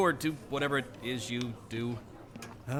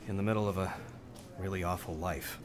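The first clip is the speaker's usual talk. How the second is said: trailing despair; 6 semitones lower, 8 dB quieter than usual. Acted. Despairing confession.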